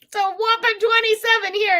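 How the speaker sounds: high, mock-whiny old granny voice. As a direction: whine like a granny complaining of cold. Mocking herself as whining granny.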